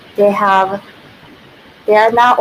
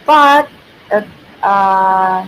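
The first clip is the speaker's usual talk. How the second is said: normal talking voice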